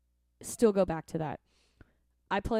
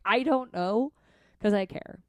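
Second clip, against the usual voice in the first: earnest; 4 semitones higher, 7 dB louder than usual. Retold or imitated. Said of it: "I'm like".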